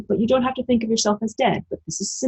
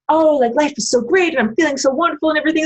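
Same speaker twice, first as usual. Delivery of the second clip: gushing happy self; 9 semitones higher, faster than usual. Retold or imitated. Voicing her own glee.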